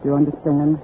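low, controlled threat; menacing order. Acted. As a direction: lay down terms to a hostage. Armed spy orders her hostage.

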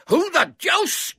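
very high, loud, drawn-out outburst; exploding in anger. A narrator's reading. Trapped man to unknown captor, exploding angrily. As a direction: explode with outrage. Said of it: "exploded the voice".

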